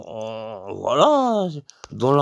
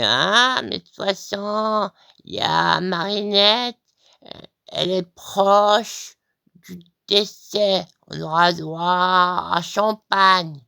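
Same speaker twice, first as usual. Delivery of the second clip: scheming guest; 5 semitones higher, 3 dB louder, slower than usual. Retold or imitated. Guest jokes about next funeral.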